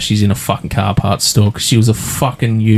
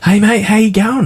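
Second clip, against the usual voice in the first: chirpy salesperson; 11 semitones higher than usual. Retold or imitated. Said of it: "She's like".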